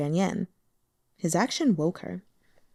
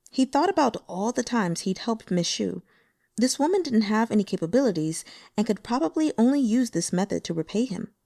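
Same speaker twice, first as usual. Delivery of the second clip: usual speaking voice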